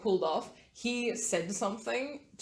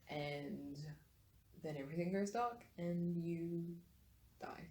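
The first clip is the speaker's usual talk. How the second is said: soft, halting fade-out; quiet, suspenseful storytelling; hushed death narration; 11 dB quieter than usual. A narrator's reading. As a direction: let the words sink away, with pauses. Game master narrates a character's death.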